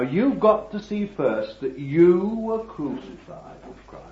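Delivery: usual speaking voice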